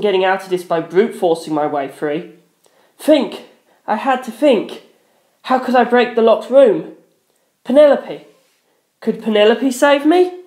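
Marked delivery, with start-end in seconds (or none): 3.0-10.4 higher, tense thinking; strained desperate inner voice; panicked. A narrator's reading. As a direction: urge yourself to think. Inner panic while being choked.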